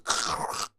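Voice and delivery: short crackling throat noise; imitated print noise. Imitating a cooled print coming off the bed, crackly noise. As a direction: imitate a part popping free with a rasped crackle. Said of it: "the print that I've printed goes".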